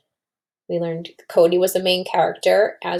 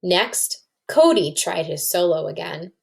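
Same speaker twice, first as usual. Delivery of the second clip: lively read-aloud to a class, voicing the kids; story narration. A narrator's reading. Teacher reading a story to her class, lively read-aloud. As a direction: read it like a picture book to children.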